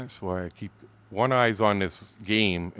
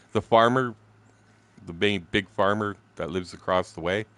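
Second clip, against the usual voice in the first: ordinary talk, nothing marked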